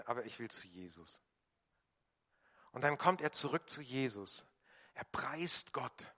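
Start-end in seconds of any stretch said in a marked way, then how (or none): 0.0-1.2 quiet resolve. Acted. Healed leper's quiet resolve.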